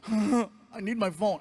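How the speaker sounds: put-on whiny teen. Son whining in retold scene.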